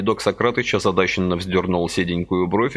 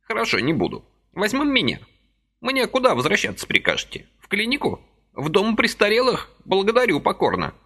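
put-on high fussy old man's voice, gently reproaching; a little old man chiding kindly; old man reproaching Vasily for his haste, high and fussy; reproachful old man; 13 semitones higher than usual. A narrator's reading.

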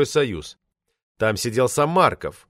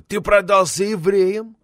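put-on drunken accusation; 7 semitones higher, slower than usual. A narrator's reading. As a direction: drag it out and accuse him.

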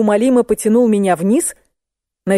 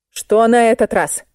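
ordinary delivery

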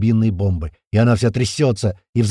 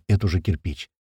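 ordinary delivery

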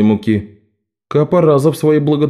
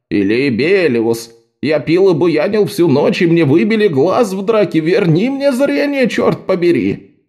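whiny patient voice; 6 semitones higher than usual. Retold or imitated. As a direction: parody a rowdy patient. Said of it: "ко мне с такими речами".

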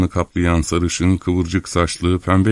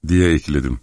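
plain reading voice

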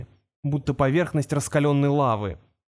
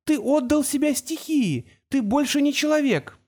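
very high, distraught accusation; breaking voice; 11 semitones higher than usual. A narrator's reading. Girl confronts the creature, voice breaking. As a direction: accuse it with your voice close to breaking. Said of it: "срывающимся голосом продолжила Тамара".

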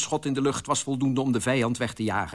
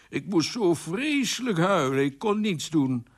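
tearful lament; 5 semitones higher than usual. Acted. King confesses he could only weep, mildly tearful. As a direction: lament it helplessly, slowing at the end. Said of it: "Waarom huil je zo?".

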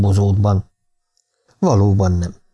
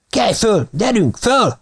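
urgent calling out; 11 semitones higher, slower than usual. A narrator's reading. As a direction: call out to rouse someone who has collapsed. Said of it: "s egy hang ezt kiáltotta". (magas hangú, sürgető kiáltás)

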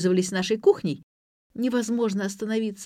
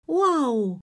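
high, bright wow; surprised exclamation; 7 semitones higher than usual. A narrator's reading. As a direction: gasp in delight. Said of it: "воскликнула Ксения".

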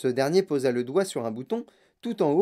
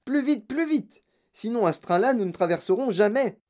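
high, frantic urging; excited, pressing shout; urgent cry; 7 semitones higher than usual. A narrator's reading. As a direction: urge them on, high-pitched. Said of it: "cria monsieur Wonka".

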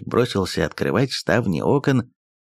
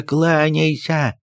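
higher, slow drawn-out order; slow insistent command; 7 semitones higher, much slower than usual. Acted. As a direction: drag it out as a scolding demand. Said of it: "кинулся на него приказчик".

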